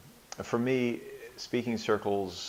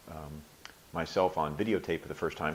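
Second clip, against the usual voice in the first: normal talking voice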